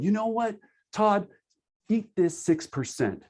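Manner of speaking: high, brash take-it-or-leave-it voice; mock pushy agent. Agent telling client Todd to take six percent, mock high brash voice. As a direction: lampoon an agent shoving the fee at the client.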